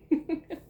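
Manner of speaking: real laugh after a wry aside. Real, not acted. Laughs after spoiler alert.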